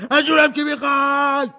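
high, loud frightened shriek; terrified cry. Acted. Terrified Scrooge crying out at the ghost, high and loud. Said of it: "اسکروچ از وحشت فریاد کشید".

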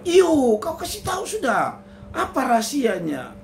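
very high, pressing husband voice; eager husband. Acted. Husband Tinus urging his wife to tell, very high and eager.

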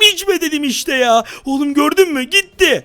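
ordinary talk, nothing marked

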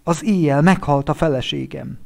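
husband breaking the news, grieving; grief-stricken news. Acted. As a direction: tell him your wife died. Friend announces wife's death.

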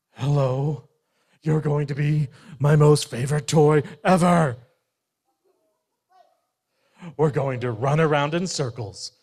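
loud, bouncy dog voice; an overexcited puppy who can't sit still; eager dog. Acted. Dog greets Bear as his new toy, loud and eager.

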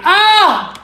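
loud, high cry of surprise; delighted shriek. Real, not acted. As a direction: yelp with delight. Reacts to next sticker.

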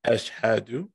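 slow, careful syllables for repeating; measured call-and-response Arabic recitation. Shown as a demonstration. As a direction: say it slowly so a learner can repeat. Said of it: "I'm gonna say it slow in Arabic".

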